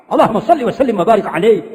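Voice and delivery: quick, loud salawat while laughing; Habib Zain. Pretend. Habib Zain reciting salawat during the Maulid, amused. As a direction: rattle off the blessing with a laugh. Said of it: "Ini Habib Zain".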